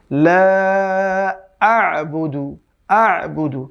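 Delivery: slow careful chant of আ'বুদু with the ain sound; Quran recitation. Shown as a demonstration. Teacher shows students the right way, slow chant. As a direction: stretch লা আ'বুদু out slowly. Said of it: "আমরা বলতে হবে".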